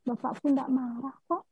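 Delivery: ordinary delivery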